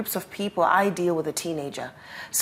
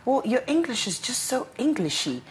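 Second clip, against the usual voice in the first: mock sniffy critic, raised pitch; quoting a critic; 7 semitones higher than usual. Someone mocking her English, a light mock-sniffy tone. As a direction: lightly imitate a dismissive acquaintance. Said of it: "and they said to me".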